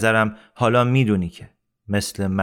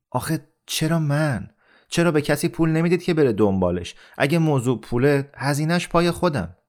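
higher-pitched, exasperated pleading; agitated protest; 6 semitones higher than usual. Acted. Amir protests to Rahim Khan about going to Kabul, agitated. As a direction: push back, voice raised in pitch.

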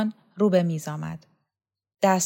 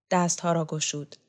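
usual speaking voice

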